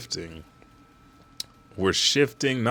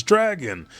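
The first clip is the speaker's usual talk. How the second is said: a higher call of the name; 10 semitones higher than usual. Retold or imitated. A Marine calling Dragon from the back, higher. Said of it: "a Marine from the back says".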